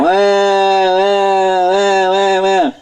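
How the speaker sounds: high, sustained mock wail; long pretend bawling on one high note; imitated baby crying. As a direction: wail "wah" like an inconsolable baby, put on. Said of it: "He won't stop crying".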